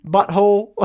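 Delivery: put-on high, loud Southern child voice; Southern little girl. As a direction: imitate a small Southern girl saying it. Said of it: "she's got this unbelievable Southern accent".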